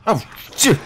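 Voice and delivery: sudden high yelp; startled curse. Real, not acted. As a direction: jump and swear. Startled by monster in game.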